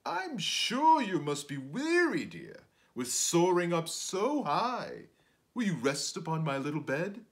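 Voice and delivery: a sly host luring his guest; spider voice. Acted. Spider inviting the fly to rest, loud sly coaxing. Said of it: "said the spider to the fly".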